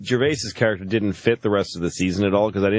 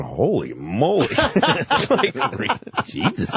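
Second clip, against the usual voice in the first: awed husband at home; 7 semitones higher, slower than usual. Retold or imitated. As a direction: murmur it like a dazzled viewer on the couch. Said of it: "he pictured the guy going".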